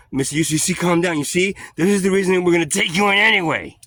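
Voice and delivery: high, loud mock calming; condescending cop. Cop tells him to calm down, loud and high imitation.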